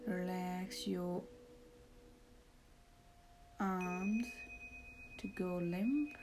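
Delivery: soft, slow meditation voice; calm, hushed, lead them into relaxation; guided meditation. Acted.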